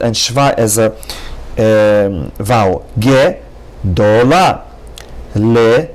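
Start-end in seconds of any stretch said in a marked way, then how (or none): 3.0-4.6 "gedola" slowly, ge as its own syllable; ge-do-la, slow and separated. Shown as a demonstration. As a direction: stretch out "gedola" beat by beat. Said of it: "just the sound ge is already a syllable".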